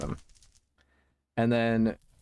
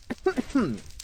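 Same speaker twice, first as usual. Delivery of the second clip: sudden cough; 12 semitones higher than usual. Real, not acted. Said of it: "losing my voice".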